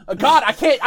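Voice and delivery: usual speaking voice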